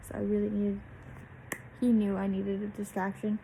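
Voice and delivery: normal talking voice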